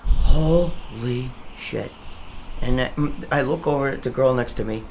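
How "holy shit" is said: stunned whisper-like gasp. Retold or imitated. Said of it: "I was like".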